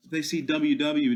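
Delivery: ordinary delivery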